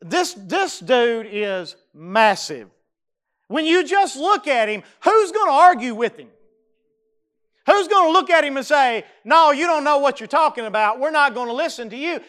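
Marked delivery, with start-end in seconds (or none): 3.5-12.2 louder and higher, pressing the question home; fervent preacher's challenge; rising preaching fervour. Acted. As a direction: ramp it up, daring anyone to argue. Preacher building rhetorical challenge.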